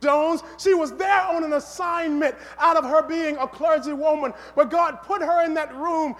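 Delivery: emphatic sermon storytelling, voice lifted; preaching at full force. Real, not acted. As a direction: drive the story's climax home to the congregation.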